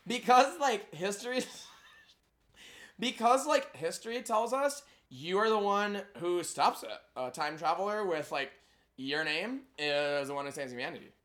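lightly voiced Valley Girl, giggly; bubbly Valley Girl with a laugh breaking in. Explaining the prophecy in a giggly Valley Girl accent. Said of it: "What about Valley Girl?".

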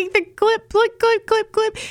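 high, clicky clip clip sounds; nail clipper imitation. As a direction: mimic the snip of toenail clippers. Mimicking coworker clipping toenails.

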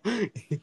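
short high burst of laughter. Real, not acted. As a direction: squeal with laughter. Laughing at memory.

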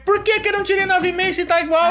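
high, loud indignant complaint; angry protest. Retold or imitated. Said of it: "Eu fiquei muito puto. Falei". (reclamação indignada, aguda e alta)

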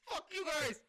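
high, loud mock yell; defiant shout. Captain cursing pursuing police, hammed-up defiant shout. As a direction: hurl it back at the pursuers.

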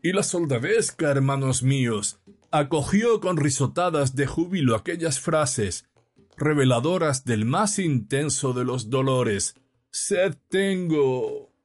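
high, declaiming pulpit voice; mournful preacher. A narrator's reading. Priest preaching on the soldiers at Calvary, high mournful declamation. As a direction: declaim high, ending in a slow lament. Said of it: "continuaba el sacerdote".

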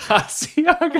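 put-on high laughing Italian accent; giggly mock Italian accent; bad Italian accent. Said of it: "You're ter".

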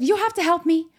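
high, loud mocking imitation; demanding attitude. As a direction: mimic a pushy woman loudly demanding help. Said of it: "comes in with an attitude".

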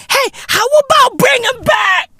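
high, wailing plea to bring him back; whiny cry-shout. Acted. Comedian begging audience to bring back Mbeki. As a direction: beg like a crying fan. Mock pleading outburst.

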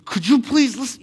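tense, high plea through gritted teeth; exasperated, straining to stay polite. Shown as a demonstration. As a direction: force it out through clenched teeth. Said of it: "gritting your teeth".

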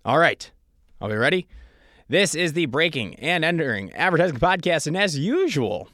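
loud, rousing podcast host kickoff; show opener announcement. Acted. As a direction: launch the show with big announcer energy.

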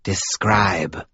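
a hint of the same odd sibilant caller; clipped one-word demand in an eerie voice; unsettling caller voice. A narrator's reading. Stranger on the phone demands details, eerie and terse. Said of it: "something intrinsically unsettling about the voice".

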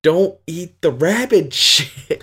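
high, slow mock scolding that breaks into a laugh. Owner mock-scolding her dog over rabbit droppings, laughing. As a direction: scold the dog but crack up mid-line.

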